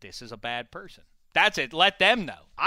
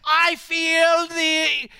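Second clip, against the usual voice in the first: shouting; 11 semitones higher, 5 dB louder, much slower than usual. Acted. Host shouting, clip-like.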